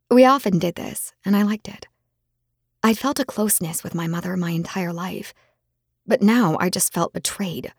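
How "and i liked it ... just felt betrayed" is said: usual speaking voice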